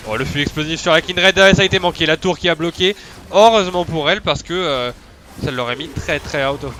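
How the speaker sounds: loud excited play-by-play; esports caster hype. Acted. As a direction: call the play with a burst of hype. (commentateur esport excité)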